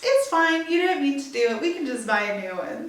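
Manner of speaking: high, loud bright reassurance that fades at the end; upbeat self-comfort, easing down; cheery reassurance. Retold or imitated. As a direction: sell it as no big deal, high and loud. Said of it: "it's really helping me on".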